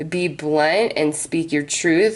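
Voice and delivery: usual speaking voice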